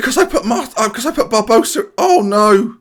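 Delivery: high, gasping panic; sudden dread; horrified realisation. Real, not acted. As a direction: gasp and blurt out the mistake. Realises own selection mistake.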